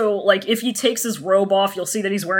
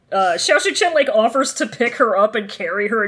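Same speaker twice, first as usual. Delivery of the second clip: usual speaking voice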